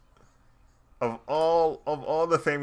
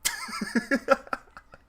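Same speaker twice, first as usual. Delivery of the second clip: very high-pitched burst of laughter; helpless laughter; 3 dB louder than usual. Real, not acted. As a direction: crack up for real, high and unrestrained. Cracks up at the accent.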